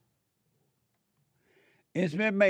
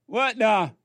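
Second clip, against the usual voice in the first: sudden louder, higher what the; mock shock; 7 semitones higher, 5 dB louder than usual. Real, not acted. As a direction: exclaim in mock disbelief. Mock surprised exclamation.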